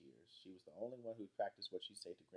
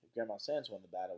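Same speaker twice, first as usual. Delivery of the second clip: ordinary delivery, nothing marked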